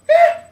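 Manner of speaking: a very high, loud "eh"; mock shrug. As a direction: brush it off with a squeaky shrug. Shrugs off the result.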